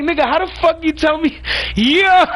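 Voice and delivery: high, loud laugh through the words; laughing disbelief. Real, not acted. Laughing at the crew leader's lie, high and loud. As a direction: crack up mid-question at the obvious lie.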